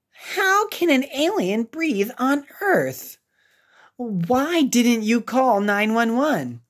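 lightly voiced curious kids; a hint of excited children; child classmates. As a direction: ask like eager little classmates. Kids asking questions voiced.

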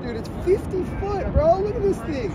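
high-pitched amazed yelp; excited. Real, not acted. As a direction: gasp at the giant wave. Huge wave set rolling in.

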